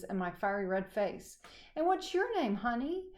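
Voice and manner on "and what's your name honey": much higher, sugary motherly question; sweet mom voice. Acted. Mom sweetly greeting the neighbor girl, high and sugary. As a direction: coo it like a doting mom.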